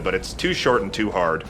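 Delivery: usual speaking voice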